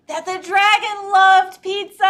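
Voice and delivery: high, loud, gleeful storytelling; delighted narrator crescendo; excited reveal. A narrator's reading. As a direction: sing out the happy twist. Happy twist in the story.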